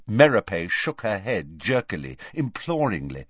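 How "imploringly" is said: ordinary delivery, nothing marked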